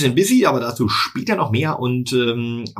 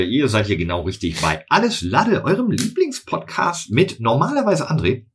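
normal talking voice

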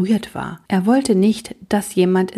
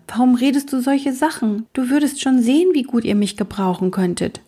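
usual speaking voice

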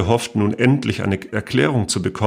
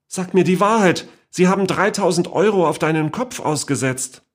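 higher, pressing tone; urgent plea; 9 semitones higher than usual. A narrator's reading. Niece pleading with the great-aunt, urgent and higher. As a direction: urge her to come clean.

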